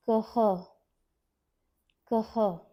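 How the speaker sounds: "kaha" with a breathy h at the end; "कः" aspirated visarga. Shown as a demonstration. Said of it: "This matra carries a h".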